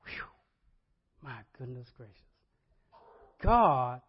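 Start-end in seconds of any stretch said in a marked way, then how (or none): 0.1-2.2 soft, low amazed murmur; hushed wonder. Real, not acted. Preacher awed by God's work, soft and low. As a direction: breathe it in quiet awe. Awed aside after story.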